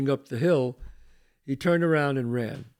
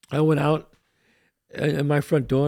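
ordinary delivery

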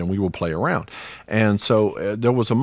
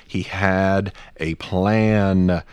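very slow, each word drawn out; slow and deliberate; much slower than usual. Real, not acted. Podcaster explaining God's plan, slow and deliberate. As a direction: spell it out word by word, unhurried. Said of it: "I'm doing old preacher".

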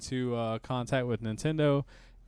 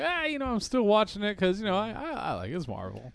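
a hint of a whiny fanboy; lightly voiced gushing fan; mock fanboy voice; 9 semitones higher than usual. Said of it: "he'd be like".

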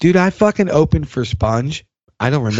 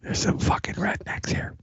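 hushed, voiceless aside, much softer; confiding whisper; 10 dB quieter than usual. Pretend. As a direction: whisper it like a secret. Jokingly confiding about local rednecks.

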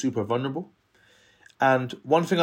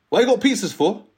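put-on suspicious kid; 8 semitones higher, 5 dB louder than usual. Kid challenging him about pizza.

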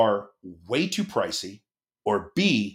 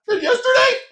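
tearful; 11 semitones higher than usual. Retold or imitated. Tearful declaration to wife.

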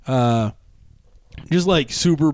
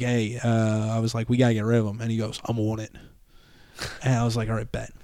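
softer, lower confiding to the bartender; hushed aside; 7 semitones lower, 7 dB quieter, faster than usual. Retold or imitated. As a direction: lean in and keep it low.

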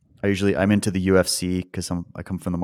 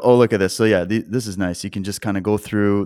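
normal talking voice

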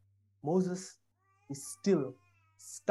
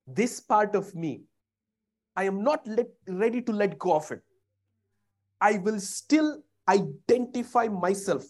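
ordinary delivery

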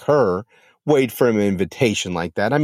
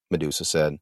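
plain reading voice

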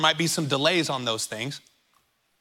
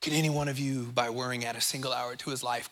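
hushed, much softer and lower, a philosopher pondering aloud; mock existential musing; 6 semitones lower, 8 dB quieter than usual. Shown as a demonstration. Jesus to the Greek philosophers, hushed existential musing. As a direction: drop the voice and muse it like a riddle. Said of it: "He's getting all existential with them".

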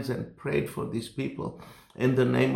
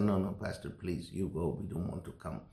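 hushed pleading; 3 semitones lower, 8 dB quieter than usual. Retold or imitated. Villagers pleading, dropping to hush.

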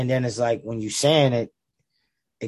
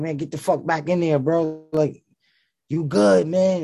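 higher-pitched imitation of an insistent friend; mock exasperation; 6 semitones higher, 3 dB louder than usual. Friend sending rapper back into the booth, retold exasperated reassurance. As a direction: wave him off, insistent but reassuring, voice lifted.

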